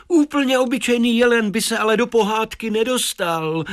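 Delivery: put-on high-pitched boy's objection; objecting child. A narrator's reading. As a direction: protest high, as a little boy. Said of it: "namítl Tadeáš". (namítající vysoký dětský hlas)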